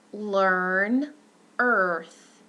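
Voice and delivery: learn and earth with a clear er; demo words. Shown as a demonstration. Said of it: "I'll show you the next one".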